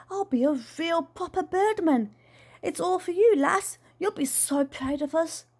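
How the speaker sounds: excited Dad, pitched well up; high, buoyant birdman dreamer; eager Dad character. A narrator's reading. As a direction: boast with childlike enthusiasm to your daughter.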